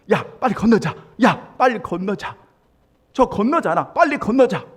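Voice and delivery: put-on loud, pushy mother hurrying her child; mild mock mother's urging shout. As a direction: tug the kid along, yelling hurry. Mother urging child across street.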